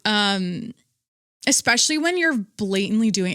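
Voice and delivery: usual speaking voice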